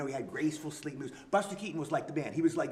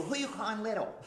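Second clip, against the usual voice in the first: higher, offended little retort; indignant comeback; 6 semitones higher than usual. Retold or imitated. Eddie retells his ad-lib to the cop, mock-indignant and higher. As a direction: snap it back, mock-insulted. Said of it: "And I go".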